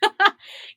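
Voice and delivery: short high giggle; sudden real laugh; high burst of laughter. Real, not acted. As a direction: break into a laugh. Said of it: "I began laughing".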